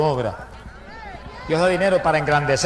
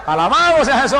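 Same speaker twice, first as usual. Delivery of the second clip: high, loud cry of praise; shouted praise; 9 semitones higher, 6 dB louder than usual. Real, not acted. Preacher to the congregation, a high shouted praise. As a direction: throw it up to heaven.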